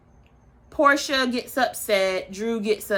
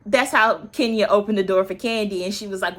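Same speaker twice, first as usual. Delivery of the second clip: ordinary delivery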